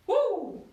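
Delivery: high, loud whoop; excited whoop. Real, not acted. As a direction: cheer the class on with a whoop. Coach cheering during the set.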